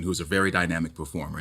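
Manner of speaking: normal talking voice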